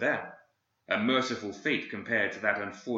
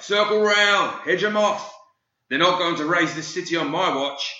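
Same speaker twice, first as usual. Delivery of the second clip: high, loud commanding shout; crying out orders; 8 semitones higher, 7 dB louder than usual. Acted. Guild sergeant shouts to his men during the riot, commanding yell. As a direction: bellow the orders over the burning chaos. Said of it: "He cried".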